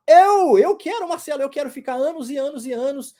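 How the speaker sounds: loud, high, eager student shouting out; mock eager student. Teacher voices a pretend student answering his question, eager and loud. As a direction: play a keen student blurting out an answer.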